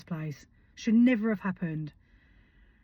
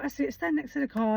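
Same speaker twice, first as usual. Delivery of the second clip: coaxing; 7 semitones higher than usual. Retold or imitated. Coaxing son into photo pose.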